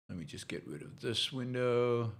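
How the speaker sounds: raspy, worn-out voice; hoarse voice. Real, not acted. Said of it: "I'm losing my voice a little bit".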